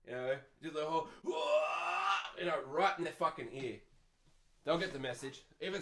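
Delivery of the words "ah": mock Super Saiyan scream; drawn-out yell, like powering up; Goku power-up scream. Shown as a demonstration. Demonstrating screaming in a sleeper's ear, Super Saiyan yell. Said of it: "like your Goku turning Super Saiyan".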